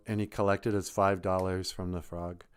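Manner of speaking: ordinary delivery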